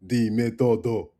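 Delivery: loud, slow, dumb caveman. Pretend. Teacher mocks a caveman saying todo. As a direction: lumber it out like a caveman. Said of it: "Goddamn caveman".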